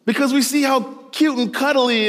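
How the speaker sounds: ordinary delivery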